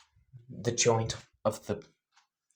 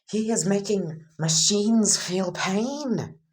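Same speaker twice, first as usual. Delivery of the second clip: a rough attempt at a Transylvanian accent, higher and rising into a rant; bad Transylvanian accent; 7 semitones higher, 3 dB louder than usual. Vlad King rants about the psychopath kid, bad Transylvanian accent. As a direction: ham up a poor Transylvanian accent as panic builds. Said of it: "I can't do a Transylvanian accent, so this is the best you get".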